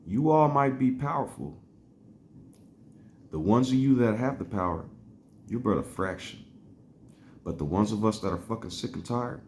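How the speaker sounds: rallying speech. Retold or imitated. Quoting a cartoon figure to the powerful, stirring declamation.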